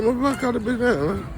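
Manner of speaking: high, fast quoted remark; quoting a woman. Retold or imitated. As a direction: rattle it off fast in her high voice. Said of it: "She said".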